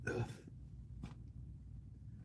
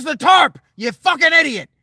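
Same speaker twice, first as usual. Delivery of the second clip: much higher, very loud furious shout; granddad shouting; 13 dB louder than usual. Retold or imitated. Granddad shouting the answer at the clueless kid. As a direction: scream it like an exasperated old man. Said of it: "And he goes".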